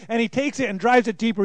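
ordinary talk

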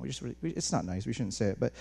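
usual speaking voice

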